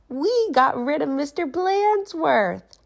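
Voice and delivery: high, slow gloating cry; gleeful kids. Acted. Kids gloat about the principal, high and gleeful. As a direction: savour the victory. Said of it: "cried the class".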